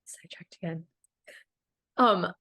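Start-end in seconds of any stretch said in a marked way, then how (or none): 0.0-0.8 whispered aside, unvoiced breath; a little self-mocking whisper to herself. Real, not acted.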